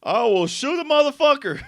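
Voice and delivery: loud, high, whooping amazement; hyped-up reaction. Retold or imitated. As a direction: exclaim it loudly, fresh off a laugh. Amazed reaction to a pulled pistol.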